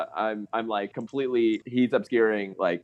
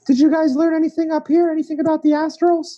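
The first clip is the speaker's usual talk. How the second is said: high character voice; 6 dB louder than usual. Acted. Player voices his character.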